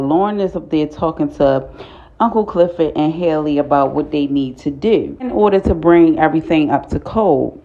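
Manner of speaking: ordinary delivery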